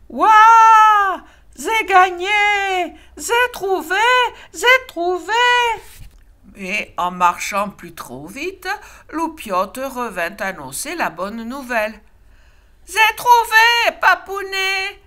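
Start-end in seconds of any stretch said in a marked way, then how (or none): 0.1-5.3 triumphant cub. A narrator's reading. Loupiote triumphs again. 12.9-15.0 joyful shout. A narrator's reading. Loupiote shouts to papa.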